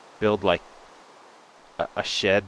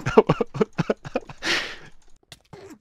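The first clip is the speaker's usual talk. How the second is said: high squealing laugh. Real, not acted. Chased by enderman.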